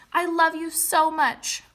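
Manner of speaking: loud, high, gushing declaration; big excited I love you so much; exclamatory delivery. Shown as a demonstration. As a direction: say it bursting with feeling. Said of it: "expresses a strong emotion".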